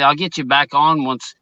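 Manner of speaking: ordinary talk, nothing marked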